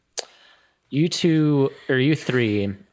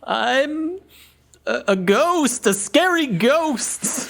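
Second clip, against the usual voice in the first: high, stammering would-be spooky voice; timid creature badly faking a scary ghost; meek voice bluffing; 3 dB louder, slower than usual. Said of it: "this meek voice".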